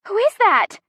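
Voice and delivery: high, loud, alarmed cry; shrieked. A narrator's reading. Chrissy sees SUV charging at car, shrieking. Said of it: "Chrissy shrieks".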